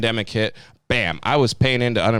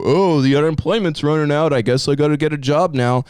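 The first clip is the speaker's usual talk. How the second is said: higher, mocking slacker voice, lightly put on; mock whiny slacker; 5 semitones higher, 4 dB louder than usual. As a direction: sneer it like a lazy quitter. Mocking lazy job seekers.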